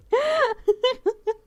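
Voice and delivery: high, loud burst of laughter; laughing. Real, not acted. Streamer amused by the car mishap, laughing. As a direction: burst out in high-pitched giggles.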